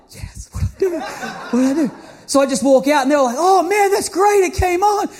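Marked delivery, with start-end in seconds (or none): 0.1-1.9 airy, laughing whisper of relief; breathless excited whisper. Retold or imitated. Alone in the cupboard when the light comes on, whispered excited relief. 3.4-5.1 excited cheer. Retold or imitated. Residents cheer the fixed lights.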